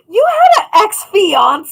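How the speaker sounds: gasping, high and loud, truly stunned; shocked gasp. Real, not acted. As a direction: gasp then shout the question in disbelief. Shocked at friend's secret engagement.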